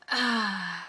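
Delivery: loud, drawn-out sigh; weary sigh. Real, not acted. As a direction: heave a big sigh before a hard topic. Finds the voice topic tough.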